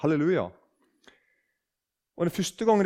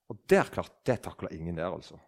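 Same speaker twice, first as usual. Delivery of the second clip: ordinary talk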